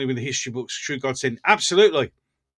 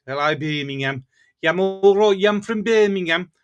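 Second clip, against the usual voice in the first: mock Brummie accent; 3 semitones higher, 5 dB louder than usual. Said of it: "They always go like that".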